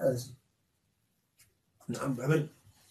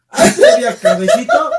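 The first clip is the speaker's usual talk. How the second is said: mock waiter, much higher and louder through a laugh; laughing imitation; 14 dB louder than usual. Friend laughing while imitating the waiter, much higher and louder. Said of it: "imitating waiter with laugh".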